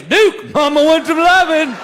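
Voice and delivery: playful call to the dog. Retold or imitated. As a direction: call Duke over like a doting owner. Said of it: "all I say is".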